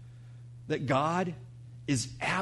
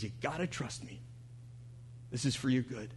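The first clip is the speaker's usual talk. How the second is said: low-key, softly spoken parent; quiet reassurance; 3 semitones lower, 10 dB quieter than usual. Retold or imitated. Parent to a toddler getting vaccinated, quiet reassurance. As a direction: drop to a soft, gentle plea for trust. Parent consoling child before a shot.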